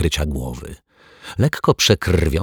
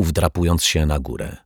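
ordinary delivery

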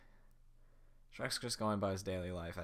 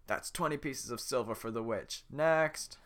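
head guard voice; 4 semitones higher, 7 dB louder, slower than usual. Acted.

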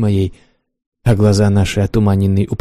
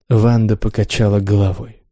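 usual speaking voice

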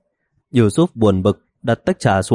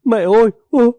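high-pitched, startled cry out; sudden high yell for mum; sudden scream; 13 semitones higher, 3 dB louder than usual. A narrator's reading. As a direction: yelp it out suddenly, pitch shooting up. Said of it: "Sít Xê bỗng hét lên".

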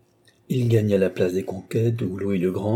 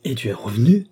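louder, indignant question; angry reproach; 2 semitones higher, 5 dB louder than usual. A narrator's reading. Baron to his son back without the sister, angry reproach. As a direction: throw the question as an accusation. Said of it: "avec une colère".

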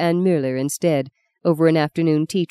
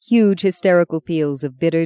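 ordinary delivery

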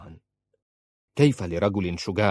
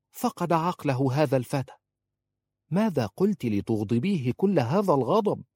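higher-pitched, incredulous questioning; scandalised, higher woman's voice; exasperated woman character; 5 semitones higher than usual. A narrator's reading. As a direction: react with baffled exasperation, voice lifted. Khalisa astonished at his rage.

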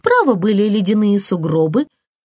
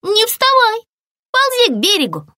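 высокий предостерегающий окрик зайчика; предупреди высоко и настойчиво, спасая друга; срочное предупреждение; 10 semitones higher than usual. A narrator's reading. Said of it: "предупредил его зайчик". (высоким голосом предостерегает)

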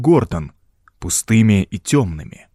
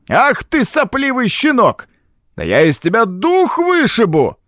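furious roar; 11 semitones higher than usual. A narrator's reading. Old Baines threatens Gordon, in a furious high roar. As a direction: roar it like an enraged old man. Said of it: "яростный рёв Бэйнса".

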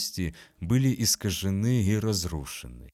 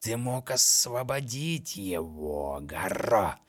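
taunting monster voice; slower than usual. Acted. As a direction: taunt him slowly and cruelly.

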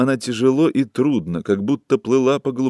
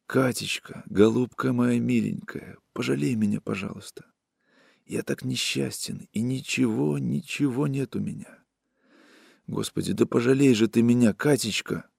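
much softer, pleading plea; begging softly; 7 dB quieter than usual. A narrator's reading. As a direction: beg her quietly, near tears. Pavel begging, drunk and weeping.

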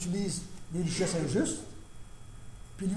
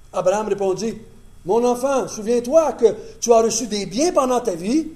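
louder, higher public Bible reading; scripture reading; 6 semitones higher, 9 dB louder than usual. Acted. Preacher reading Luke to the congregation, loud and lifted. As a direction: proclaim the parable to the room. Reads parable with voiced quotes.

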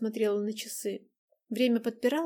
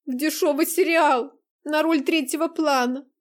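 tearful shout; 6 semitones higher, 9 dB louder than usual. Acted. Tearful outburst continues.

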